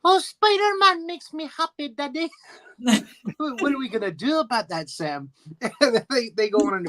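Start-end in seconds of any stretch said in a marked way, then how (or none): 0.0-2.3 put-on high, sweet child voice, much higher; little boy imitation, pitched up. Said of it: "little Sam is so cute". 3.4-5.3 lightly voiced playful dad; a dad playing along with his son; a hint of a cheery father; imitating the father. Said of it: "his father always asks him".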